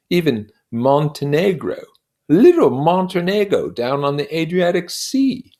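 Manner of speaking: high, loud, swelling with pride; proud exclamation. Acted. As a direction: lift it up like a trophy. Proud listing of his medals.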